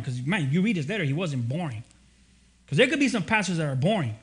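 ordinary talk, nothing marked

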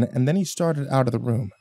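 plain reading voice

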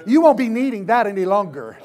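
fired-up pulpit shout; shouted declaration. Real, not acted. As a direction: proclaim it forcefully to the congregation. Same words preached out loud.

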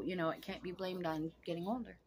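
normal talking voice

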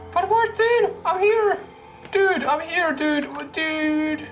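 normal talking voice

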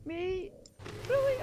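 high incredulous outcry; can't believe it's me; outraged disbelief. Real, not acted. Outraged disbelief after death.

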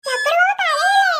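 slow, tender comforting of a kitten; soothing. Acted. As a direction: coo to the kitten to stop it crying. Girl soothing the wet kitten. (പൂച്ചക്കുഞ്ഞിനെ പതുക്കെ ആശ്വസിപ്പിക്കുന്നു)

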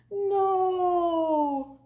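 high, loud, long-held cry; crowd yell. A narrator's reading. Crowd refuses to be Eagle's lunch, yelling. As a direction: wail it out as one crowd. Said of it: "yelled the crowd".

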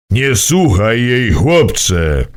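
a firm elder's exclamation; urgent old man. A narrator's reading. Mimo urgently warns the boy, firm elder voice. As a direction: cry out firmly as an old man. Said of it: "wykrzyknął Mimo".

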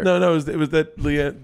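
ordinary talk, nothing marked

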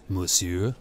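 low, soft character voice for the dealer; calm, deadly serious. A narrator's reading. Gallery owner approaching the old man, calm and serious. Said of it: "He was calm now, deadly serious".

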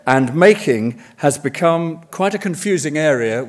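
ordinary talk

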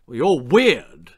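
slow, firm verdict; deliberate, detached pronouncement; clinical. A narrator's reading. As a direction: state it like a diagnosis. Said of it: "stated her father clinically".

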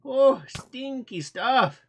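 usual speaking voice

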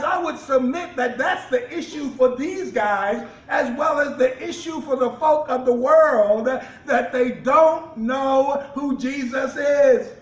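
loud shouted preaching climax. Acted. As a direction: drive it home to the congregation.

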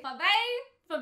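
Fave with a questioning high French "vay-fuh"; testing Fave with a rising French sound; French accent try. Shown as a demonstration. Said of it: "how you would say it with the accent".

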